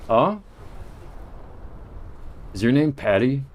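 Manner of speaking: normal talking voice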